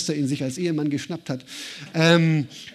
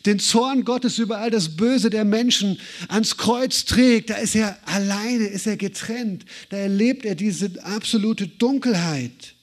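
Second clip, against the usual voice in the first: impassioned preaching; 4 semitones higher than usual. Real, not acted. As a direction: drive the climax home. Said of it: "preaching climax".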